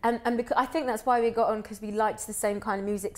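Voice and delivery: ordinary talk, nothing marked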